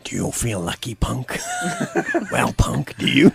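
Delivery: low, soft menacing movie line; tough-guy film threat. Acted. Host jokes after tale of strict dad, tough-guy impression. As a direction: play the hard man, mock-menacing. Film quote performed as joke.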